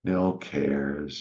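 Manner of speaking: normal talking voice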